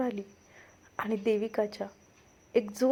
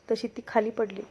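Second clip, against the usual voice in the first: usual speaking voice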